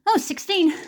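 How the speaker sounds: sudden high delighted oh; surprised. Real, not acted. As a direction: caught off guard by the roll. Rolls a sixteen on the dice.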